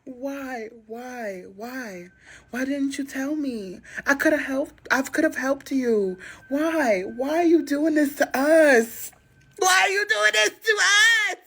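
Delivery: hammed-up crying plea, high and breaking; fake-sob it, building to a wail; high mock wail to the boyfriend; mock distraught sobbing. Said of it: "play the distraught girlfriend".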